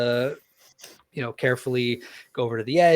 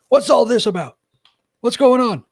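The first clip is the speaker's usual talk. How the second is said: high, loud call up to the ship; fisherman calling up; 13 semitones higher, 12 dB louder than usual. Acted. Fisherman calling up to the ship deck, loud high shout. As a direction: shout it up from a fishing boat. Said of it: "call up and go".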